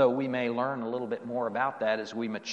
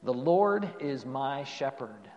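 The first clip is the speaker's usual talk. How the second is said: ordinary talk, nothing marked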